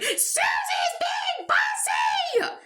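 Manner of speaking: slow, very high squealed yell; drawn-out mock shriek; yelling at top of lungs. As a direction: screech it out like a tattling cartoon kid. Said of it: "yelling at the top of her lungs".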